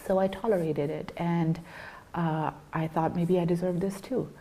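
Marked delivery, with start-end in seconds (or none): none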